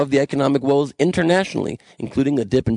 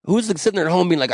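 ordinary talk

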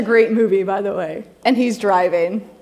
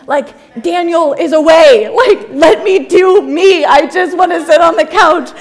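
loud whiny plea; 5 semitones higher, 6 dB louder than usual. Retold or imitated. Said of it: "I'm like".

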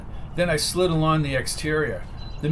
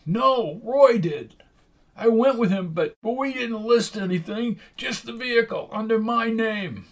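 higher, nervous informant; nervous thug voice; 8 semitones higher than usual. A narrator's reading. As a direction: spill it out, uneasy. Jack's voice, higher.

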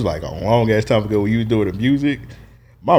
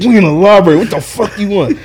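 high, raised-voice mock annoyance; exasperated retort; 9 semitones higher, 6 dB louder than usual. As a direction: act out fed up with the interruption. Acted exasperated reply in library.

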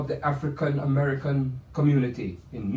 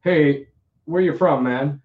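mock American asking. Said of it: "people don't ask me".

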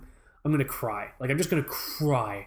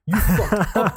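loud mock threat; 4 semitones higher, 6 dB louder, slower than usual. Mock threat repeated, louder.

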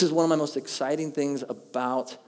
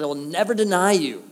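bold vow; 6 semitones higher, 7 dB louder than usual. Acted.

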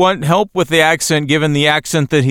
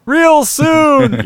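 mock Lizardo rally cry; 12 semitones higher than usual. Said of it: "his big Hitler speech".

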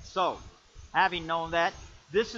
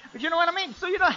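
high, loud sermon aside; full-force preaching; 13 semitones higher, 11 dB louder, faster than usual. Acted. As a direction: lift back into the sermon.

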